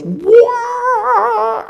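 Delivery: big high, loud roaring "Wah!"; imitating a monster roar. Describing the movie screen's huge roar, with a loud roaring "Wah!". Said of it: "the entire screen goes".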